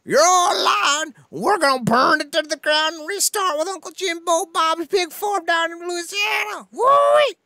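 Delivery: very high, loud hollering; shouted hillbilly rant. Acted. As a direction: holler a mock backwoods tirade, ending in a whoop. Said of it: "That really hurt my throat".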